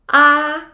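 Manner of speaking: "A" said high, loud and level; sustained high, even "A"; high flat tone. Shown as a demonstration. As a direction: hold "A" on one high, steady note. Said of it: "pronounced with a high pitch".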